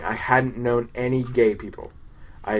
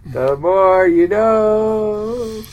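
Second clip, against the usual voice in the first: public service slogan; 5 semitones higher, 4 dB louder, much slower than usual. Pretend. PSA slogan parody.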